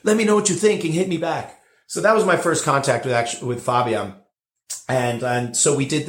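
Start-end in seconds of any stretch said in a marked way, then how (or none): 0.0-1.6 put-on German accent. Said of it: "Um, really cool German accent".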